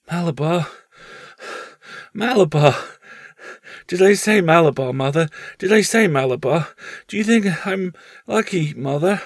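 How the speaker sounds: put-on a sick child clinging to his win; feverish dying boy. Dying boy asking his mother if he is lucky, high and dazed.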